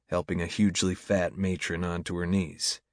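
usual speaking voice

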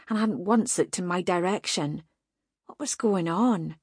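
usual speaking voice

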